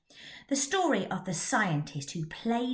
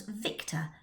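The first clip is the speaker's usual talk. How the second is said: ordinary delivery, nothing marked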